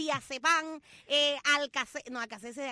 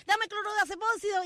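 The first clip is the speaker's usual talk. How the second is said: customer demanding; 6 semitones higher, 4 dB louder than usual. Retold or imitated. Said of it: "el otro le decía".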